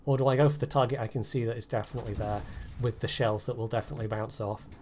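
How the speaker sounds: ordinary delivery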